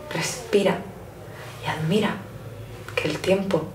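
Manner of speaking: quiet final reflection. Acted. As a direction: let it settle gently. Closing calm plea to stop.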